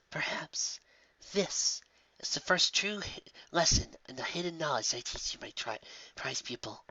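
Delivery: hushed, serious mentor voice. A narrator's reading. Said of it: "Her voice turned serious".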